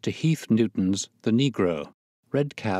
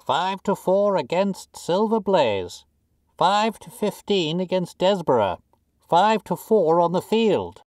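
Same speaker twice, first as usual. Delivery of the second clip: roaring bookmakers; 10 semitones higher, 5 dB louder than usual. A narrator's reading. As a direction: cry the odds across a noisy ring. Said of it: "roared the ring".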